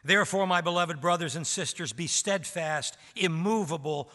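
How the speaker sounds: ordinary talk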